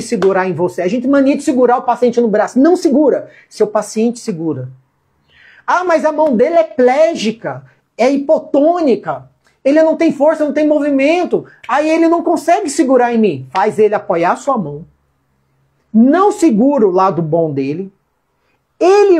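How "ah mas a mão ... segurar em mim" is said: a hint of a doubting student, pitched higher; mock student objection. Teacher voicing a student's objection, mock protest.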